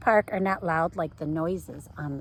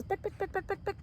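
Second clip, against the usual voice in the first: much higher, softer quick clicking; running lady's shoes clicking on the pavement; high pick pick clicks; 11 semitones higher, 6 dB quieter, faster than usual. A narrator's reading. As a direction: patter the shoes up high and light. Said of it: "and it goes".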